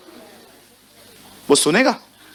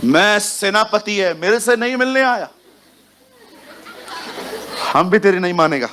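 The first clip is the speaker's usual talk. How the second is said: a hint of a haughty army commander, pitch raised; proud commander; 4 semitones higher than usual. To the congregation, Naaman's wounded pride as a mild mock boast. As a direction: play the offended general, puffed up and half-laughing.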